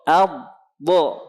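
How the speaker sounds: "Ab. Bo." as held heavy Arabic dad, then released; demonstrated dod. Shown as a demonstration. Teacher drills dod with students, heavy "Ab. Bo.". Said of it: "Baik, perhatikan".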